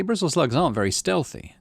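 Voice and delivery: ordinary delivery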